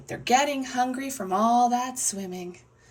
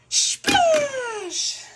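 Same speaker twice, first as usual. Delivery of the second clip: high, loud, stretched-out word; exuberant splash; 9 dB louder than usual. Acted. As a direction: make the word burst like a big splash. Storyteller voices the jump into water.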